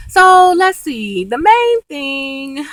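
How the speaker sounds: high, slow and stretched; slow sing-song restart; slow drawn-out. Real, not acted. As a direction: draw out the words slowly.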